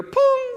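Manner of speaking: high, loud sung chime noise; imitated call-button chime. Passenger answers the captain with the call button, imitated chime. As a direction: ring out a held, high-pitched "pong". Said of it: "We hear".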